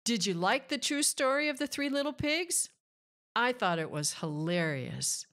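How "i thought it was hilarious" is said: plain reading voice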